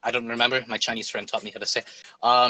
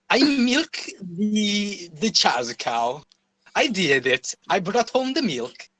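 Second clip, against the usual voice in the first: slow, drawn-out mock-Kazakh accent; comic Kazakh accent, proudly overdone; Borat; 6 semitones higher, slower than usual. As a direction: ham up a Kazakh accent, stretching the words. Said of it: "like, the Borat accent".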